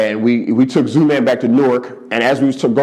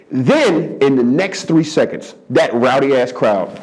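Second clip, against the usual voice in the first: normal talking voice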